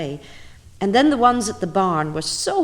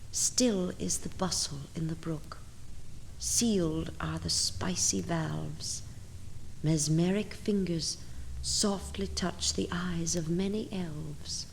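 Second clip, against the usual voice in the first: soft hush; 8 dB quieter than usual. Acted. Autumn stillness, sleepy elves.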